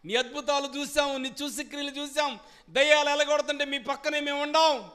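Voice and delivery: high, loud pleading shout to the Lord; desperate crying out. Acted. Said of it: "అప్పుడు కేకలు పెడుతున్నారు ఎవరు?". (కేకలు పెడుతూ ప్రభువును వేడుకోవడం)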